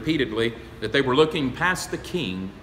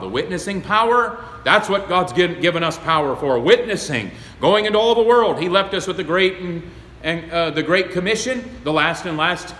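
loud, high, driving sermon voice; fiery preaching; 6 semitones higher, 5 dB louder than usual. Acted. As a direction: preach the Great Commission at full force. Preaching at full force.

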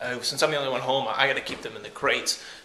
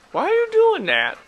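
much higher, loud disbelief; high surprised squeal; 7 dB louder than usual. Real, not acted. Dog chewing its leg.

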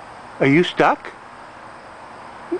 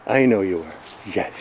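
usual speaking voice

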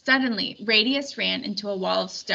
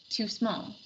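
muttered; 7 dB quieter than usual. A narrator's reading. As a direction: mutter it under your breath. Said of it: "he muttered".

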